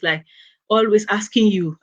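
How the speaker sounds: usual speaking voice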